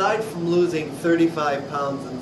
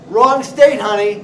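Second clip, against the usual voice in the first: higher, loud mocking put-down; sassy comeback; 5 semitones higher, 10 dB louder than usual. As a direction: land the jab with sass.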